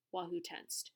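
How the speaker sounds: plain reading voice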